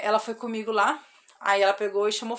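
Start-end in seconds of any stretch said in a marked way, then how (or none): none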